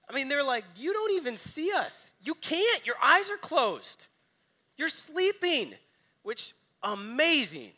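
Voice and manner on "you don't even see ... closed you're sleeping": high, loud, exasperated complaint; frantic accusation. Retold or imitated. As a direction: throw the blame at him in a panic. Disciples accusing sleeping Jesus.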